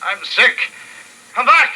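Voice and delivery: sick man begging the fleeing townsmen to come back; desperate calling out. Acted. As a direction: call after them as they run off. Sick man calling after rescuers.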